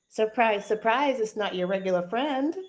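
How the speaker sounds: usual speaking voice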